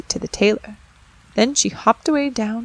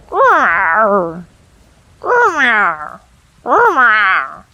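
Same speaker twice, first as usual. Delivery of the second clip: loud vexed cat growls; angry cat growl; 8 dB louder, much slower than usual. Acted. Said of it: "like a cat that is vexed".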